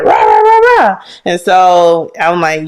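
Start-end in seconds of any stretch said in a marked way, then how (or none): 0.0-1.0 high, louder mock yell of a shouting man; a hammed-up imitation of a raised voice, high and loud. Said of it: "Somebody was just like".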